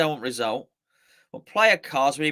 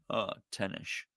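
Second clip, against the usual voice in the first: Connery punchline; 9 semitones lower, 9 dB quieter than usual. Retold or imitated. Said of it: "what time does Sean Connery get".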